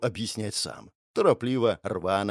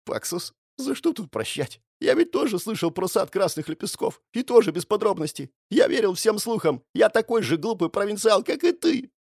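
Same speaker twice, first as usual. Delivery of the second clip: close to tears; 7 semitones higher than usual. A narrator's reading. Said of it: "чуть ли не плача".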